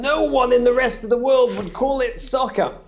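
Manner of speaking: imitating a know-it-all host; scoffing interviewer. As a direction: lecture him like a smug pundit. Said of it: "and he said".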